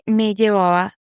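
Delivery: normal talking voice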